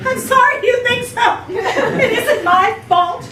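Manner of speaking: sniffle through it sadly; sniffling sadly. Acted. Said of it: "Sniffling sadly".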